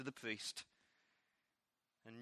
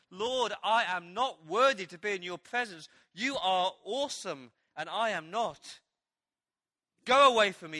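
louder and higher, swelling to a near shout; anguished plea; 4 semitones higher, 8 dB louder than usual. Retold or imitated. Peter pleads with Jesus to go away, loud and anguished. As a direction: pour out your shame to the Lord.

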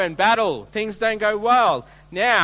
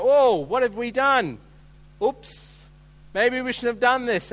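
mock regret; 5 semitones higher, 3 dB louder than usual. Mocking Israelites' shallow regret.

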